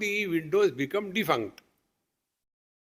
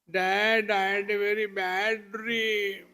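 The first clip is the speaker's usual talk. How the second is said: put-on crying child; 4 semitones higher than usual. Child telling his father of a nightmare, tearful whine. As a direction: whine it like an upset little kid. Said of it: "who got up in the morning and started crying".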